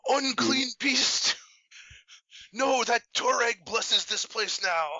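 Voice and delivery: high, slow holy battle cry; righteous shout. Acted. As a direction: cry it out like a zealous paladin. Paladin confronts the evil smoke.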